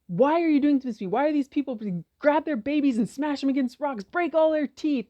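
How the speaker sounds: loud, high, raw complaint to God; anguished outcry. Retold or imitated. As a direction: cry out your fury at God. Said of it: "he did not pull his punches".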